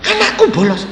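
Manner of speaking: high startled question; a father caught off guard; shocked disbelief. Retold or imitated. Father learning his son skipped school, high and shocked.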